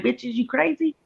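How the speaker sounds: high, soft mock-indignant dog voice; voicing the dogs. Voicing her dogs glaring at her about the smoke, high sassy mock voice. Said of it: "They looking at me like".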